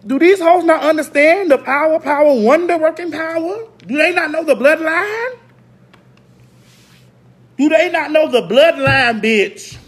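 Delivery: high, loud outraged family voice; a relative ranting loudly on the phone; family members. Retold or imitated. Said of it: "My family was calling me".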